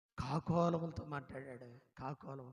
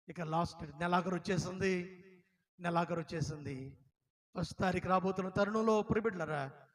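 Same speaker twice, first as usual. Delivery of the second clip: ordinary talk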